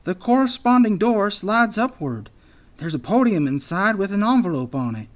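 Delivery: high, loud character voice; anxious anteater. A narrator's reading. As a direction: explain nervously, pitched well up.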